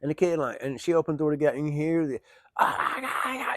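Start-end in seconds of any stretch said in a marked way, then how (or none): 2.6-3.5 whispered, high mock screams; breathy imitation of a kid wailing; imitated kid screams. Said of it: "you can hear him screaming".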